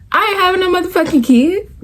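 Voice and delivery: high-pitched, incredulous refusal; indignant retort. Retold or imitated. Inner retort to granny about kids, indignant. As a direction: protest it way up high.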